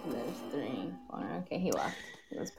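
whispering. Real, not acted. Whispered aside about viewer.

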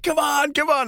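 high-pitched mock hype; forced cheery urging. Smiling performer urging the audience on, high forced cheer. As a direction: coax a still crowd in a high voice.

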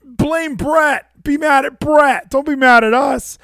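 high mocking imitation of the department; sarcastic high mock plea. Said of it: "is trying to put, like".

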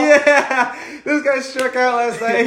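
high, slow teasing through laughter. Real, not acted. Host teasing his cohost about striking out, laughing. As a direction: rib him while laughing.

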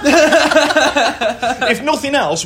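high, slow words through laughter; laughing. Real, not acted. Host consoles contestant after the attempt, laughing. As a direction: struggle to speak while laughing.

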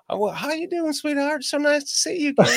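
high-pitched, syrupy mock Southern hospitality; sweet Southern greeter. Locals greeting a Northerner who walks in, sweet mock Southern greeting. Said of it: "everyone's like".

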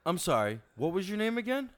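entitled Karen berating the waiter; Karen customer. Acted. Customer to waiter: entitled Karen rant. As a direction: demand the manager, cutting him off. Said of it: "we're gonna learn how to Karen".

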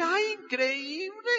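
high, slow and dazzled; amazed gasp. Retold or imitated. Young man wowed by a sales pitch, high and slow. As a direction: gush like he's just been sold.

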